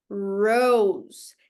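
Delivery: "Rose" with a pulled, rolled-back English R, low; strong English R. Shown as a demonstration. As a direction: pull the R hard in "Rose". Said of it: "A gente puxa".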